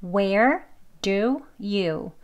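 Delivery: slow, separate "Where", "do", "you"; "Where do you" in full citation form, unhurried; careful strong form. Shown as a demonstration. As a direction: say "Where do you" word by word, fully pronounced. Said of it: "천천히 발음할 때의 발음이에요".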